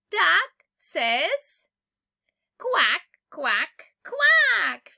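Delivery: loud playful quack imitation; duck quacking. Shown as a demonstration. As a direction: quack like a duck for a small child. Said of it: "teacher imitates the duck for a child".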